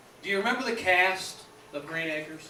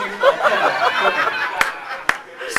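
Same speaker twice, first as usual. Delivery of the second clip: high breathless laugh; 8 dB louder than usual. Real, not acted. Laughing at his own joke.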